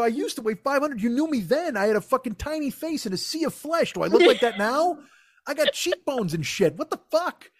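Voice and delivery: high-pitched comic outrage; aggrieved, voice held high; indignant rant. Retold or imitated. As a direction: plead your case to a friend, exasperated. Acted indignant rant at friend.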